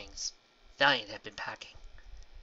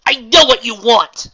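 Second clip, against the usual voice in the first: loud, high and slow shout; 13 semitones higher, 22 dB louder than usual. A narrator's reading. Celestia shouts back at Astor in anger. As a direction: shout it back at her, slowly. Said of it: "Celestia shouted".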